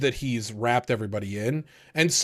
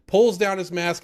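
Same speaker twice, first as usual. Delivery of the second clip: usual speaking voice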